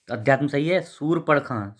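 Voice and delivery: ordinary delivery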